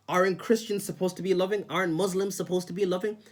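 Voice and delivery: high, pointed imitation of a pious guilt-tripper; mock religious guilt trip. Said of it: "this will be one".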